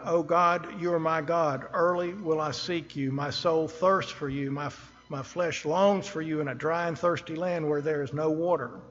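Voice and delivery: loud, ringing scripture reading. Acted. Preacher reads the psalm aloud to his church, loud and ringing. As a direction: proclaim the psalm to the congregation.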